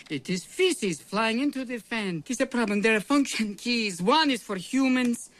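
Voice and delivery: put-on high-pitched, agitated Russian accent; flustered explanation. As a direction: explain it while still rattled. Doctor explaining mistake, still rattled.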